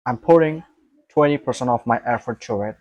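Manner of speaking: usual speaking voice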